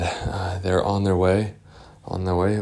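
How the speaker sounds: plain reading voice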